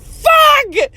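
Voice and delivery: sudden high squeal of shock; high mock yelp; mock shocked outburst. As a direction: blurt it out way up high, startled. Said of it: "she's like".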